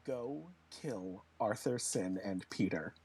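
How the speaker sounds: very low, slow sinister order; acted ominous low voice; telepathic command. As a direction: drop deep and send the order slowly. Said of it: "telepathically tell it".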